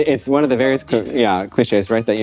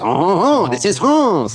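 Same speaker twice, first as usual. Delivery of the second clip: high, hammed-up French charmer; playful French candlestick voice; Lumiere French accent; 6 semitones higher, much slower than usual. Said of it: "kind of like Lumiere".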